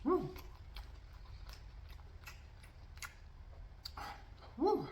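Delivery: high hooting woo; spicy gasp. Real, not acted. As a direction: react to heat in the mouth. Reaction to spicy food bite.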